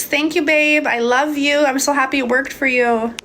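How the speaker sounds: ordinary talk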